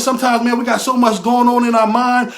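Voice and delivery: ordinary talk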